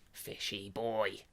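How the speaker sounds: slow low croaky coo; gurgling fish man croak. Pretend. Cooing at fish.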